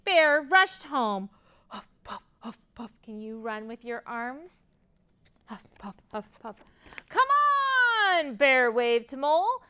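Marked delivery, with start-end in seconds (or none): 1.7-2.9 soft, airy puffs of breath; huffing and puffing. A narrator's reading. Narrator imitating Bear rushing home, huffing and puffing. Sound effects of Bear running. 5.5-6.6 soft, breath-heavy running puffs; huffing and puffing. A narrator's reading. Narrator showing children how Bear runs, huffing and puffing. 7.1-8.4 high, loud, drawn-out call. A narrator's reading. Bear waving Mole over for kite day, calling out.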